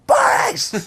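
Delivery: a high, sudden fan's yell of the name; excited fan shout. Retold or imitated. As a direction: call out to your tennis hero. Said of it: "stop myself from just going".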